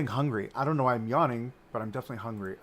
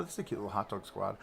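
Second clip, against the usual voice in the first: usual speaking voice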